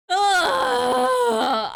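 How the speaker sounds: high, drawn-out loud wail; loud groan. Real, not acted. Worn out by the laughing fit, loud high groan.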